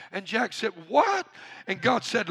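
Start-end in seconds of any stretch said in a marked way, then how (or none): 0.9-1.3 very high, loud disbelief; acted stunned outburst; incredulous. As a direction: yelp it in shocked disbelief. Stunned at order to let go.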